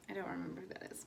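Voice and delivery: soft whispered aside; whispering. Real, not acted. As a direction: whisper it as a confession. Quiet aside, then apology to viewers.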